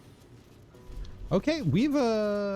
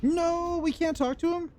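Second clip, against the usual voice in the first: surprised. Real, not acted. Game denies talking to corpse.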